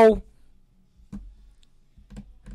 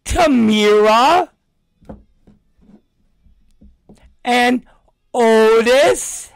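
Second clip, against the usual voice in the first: put-on higher, slow drawn-out kid listing names; mock child voice, drawn out; kid's voice; 5 semitones higher, 3 dB louder, much slower than usual. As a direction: list the names like a proud little kid. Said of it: "And he goes".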